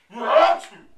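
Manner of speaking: huge, high, exploding sneeze; hammed-up sneeze, extremely loud; bigger sneeze. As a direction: let out an enormous mock sneeze at full blast. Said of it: "And she sneezed again".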